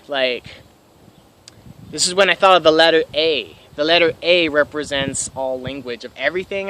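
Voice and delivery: usual speaking voice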